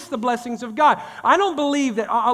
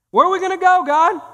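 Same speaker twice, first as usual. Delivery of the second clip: crying out; 10 semitones higher, 7 dB louder than usual. Acted. Crying out to God.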